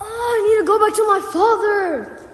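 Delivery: resolute loud cry. Acted. Son decides to return.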